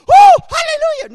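very high, loud gushing cry; mock over-the-top rejoicing; ecstatic hallelujah. Preacher mocking a gushing reaction, hammed-up ecstatic cry. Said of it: "I didn't go".

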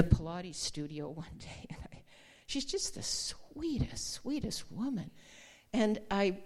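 softly whispered, hushed story; hushed retelling. Real, not acted. Pastor confiding a tender studio moment, hushed. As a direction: lean in and tell it in a hush.

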